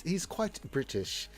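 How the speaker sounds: slow, soft posh English voice; posh British accent. Acted. Host describes a viewer as British, posh British accent. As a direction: put on an upper-class British accent. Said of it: "Very posh British".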